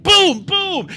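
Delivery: high, punched-out booms; imitated thuds. Storyteller imitating banging behind the shut door, loud. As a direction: voice the banging as hard exclamations.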